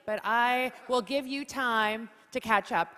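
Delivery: ordinary talk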